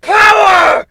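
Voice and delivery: slow, loud booming mock villain proclamation; hammed-up villain rant. A narrator's reading. Griffin fakes embracing the power, hammed-up villain roar. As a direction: shout it like a drunk-on-power overlord.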